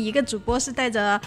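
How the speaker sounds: ordinary talk, nothing marked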